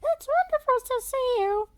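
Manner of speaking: put-on high girl voice, welcoming; Freda's voice. A narrator's reading. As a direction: greet them in a high girl voice. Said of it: "Freda welcomed them".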